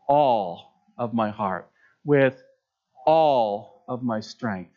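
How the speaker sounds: slow, with "all" leaned on every time; stress on all. Shown as a demonstration. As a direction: linger on each "all", slowly. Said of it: "put the emphasis on the word all".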